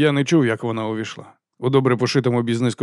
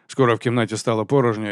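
usual speaking voice

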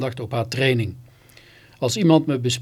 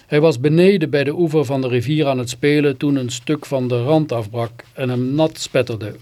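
plain reading voice

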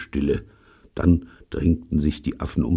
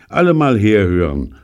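loud, raised call for attention; commanding call; 4 semitones higher, 7 dB louder than usual. Acted. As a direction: order everyone to listen up. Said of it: "gebot er". (laut gebietend)

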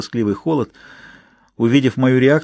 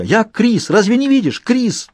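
much higher, pleading shout; desperate cry; 10 semitones higher, slower than usual. A narrator's reading. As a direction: cry out to be recognized. Husband pleading to be recognized.

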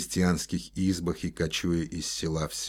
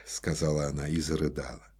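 usual speaking voice

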